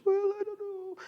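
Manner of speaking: very high, soft squeaky parody; mousy voice. Acted. Showing what gentleness doesn't mean, mock mousy voice. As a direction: put on a tiny, timid mouse of a voice. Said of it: "take on this mousy voice".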